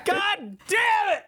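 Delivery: high, slow angry shout; frustrated shout. Real, not acted. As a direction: yell it out in defeat. Losing the guessing game again.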